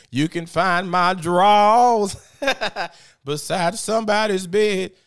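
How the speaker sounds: loud, high, drawn-out quote with a laugh; mock declaration. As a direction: proclaim it slowly and loudly, breaking into laughter. Acted mocking quote with laughter.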